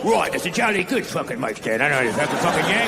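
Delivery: a cheeky English stagehand insulting the Yank; mock British. Angry mic stand guy scolding the American, mock British. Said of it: "the mic stand guy's all fucking pissed off".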